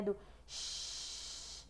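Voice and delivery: ch- breathed out as a soft hiss with no voice; ch sound. Shown as a demonstration. Teacher demonstrates the ch sound to students, hissed. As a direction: let the ch- hiss like falling rain. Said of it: "A repetição do che-, do C H".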